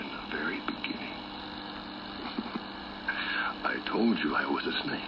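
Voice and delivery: very soft, low, halting hush; hushed villainous murmur with a small chuckle; sinister snake reveal. Acted. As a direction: let the snake confide it darkly, barely voiced.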